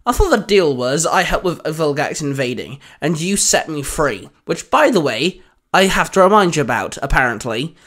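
plain reading voice

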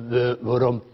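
halting, stammering on every first syllable; stuttering imitation. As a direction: imitate a stutterer tripping over be- and de-. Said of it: "Louietje stotterde een beetje".

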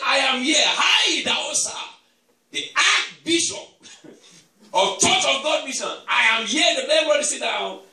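loud, high bold proclamation; commanding declaration. Retold or imitated. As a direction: stand tall and command the panicking passengers. Bishop declaring on crashing plane.

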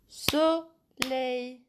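Soleil said slowly in two clapped beats, so-leil; clapping syllables. Shown as a demonstration. Teacher clapping out the syllables of Soleil for pupils. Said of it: "j'ai applaudi deux fois".

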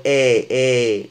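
"إي" as a loud, open eh; open-mouthed vowel. Shown as a demonstration. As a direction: stretch "إي" with the mouth wide open to the ears. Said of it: "مع الفم مفتوح".

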